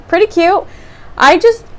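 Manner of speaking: high, loud burst; excited yelling. Real, not acted. Showing viewers the finished nails, yelling. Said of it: "I'm, I'm yelling".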